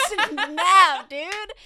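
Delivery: high, loud laughing exclamation; laughing excitedly. Real, not acted. Game master reacting to a natural twenty, laughing loudly. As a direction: burst out laughing in delight.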